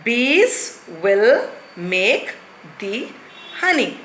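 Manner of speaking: very slow, word-by-word reading; slow model sentence. Shown as a demonstration. Teacher slowly builds the proper sentence for the class. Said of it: "now we'll make it into proper sentence".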